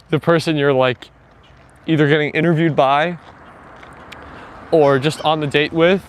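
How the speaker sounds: usual speaking voice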